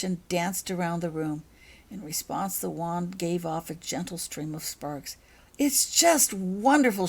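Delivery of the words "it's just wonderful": slow, high and loud gush. Acted. Becky admiring her new wand, slow high gushing. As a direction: savour how wonderful it is. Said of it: "she gushed".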